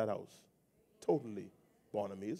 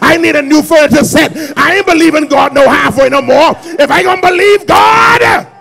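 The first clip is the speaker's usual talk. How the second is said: high, roaring preacher's shout; full-force preaching; 11 semitones higher, 28 dB louder than usual. Real, not acted. Preacher declaring bold faith for the whole thing, full-force preaching. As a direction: shout each demand, climbing to a peak.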